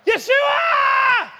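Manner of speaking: high, loud, long-held holler; acted desperate shout; crying out. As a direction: holler the name to someone far off. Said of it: "The word says he began to what?".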